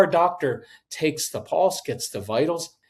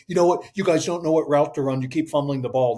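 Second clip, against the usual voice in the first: mock scolding huddle rant; hammed-up blaming captain; berating quarterback; faster than usual. Quarterback chewing out his team, mock angry.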